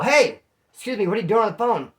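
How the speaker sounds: stern coworker. Retold or imitated. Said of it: "this dude's all".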